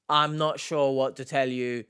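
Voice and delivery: ordinary talk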